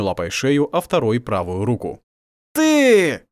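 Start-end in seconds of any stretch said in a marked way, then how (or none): 2.5-3.2 loud snarling growl. A narrator's reading. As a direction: snarl it like a wolf. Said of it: "прорычал он".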